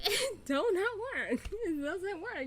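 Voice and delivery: words squeezed out through high laughter; real giggling disbelief; laughing. Real, not acted. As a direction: laugh while you insist it doesn't work. Laughing at the show's absurdity.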